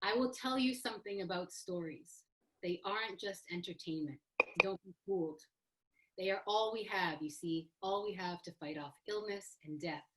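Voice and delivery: soft, hushed reading of a quote, whispering don't be fooled. Acted. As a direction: read it like a solemn closing passage. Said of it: "I wanna read the Leslie Marmon Silko quote".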